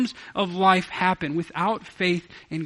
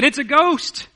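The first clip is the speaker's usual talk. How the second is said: higher and louder, a startled cry of fear; frightened outcry; 9 semitones higher, 7 dB louder, slower than usual. A narrator's reading. Disciples see Jesus on the water, a frightened cry. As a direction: disciples terrified at a figure on the sea, crying out in fear. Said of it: "And they cried out in fear".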